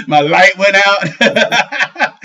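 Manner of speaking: slow words through laughter; laughing. Real, not acted. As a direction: laugh while saying it. Laughing at own mishap.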